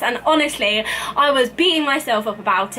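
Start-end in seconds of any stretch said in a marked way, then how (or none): none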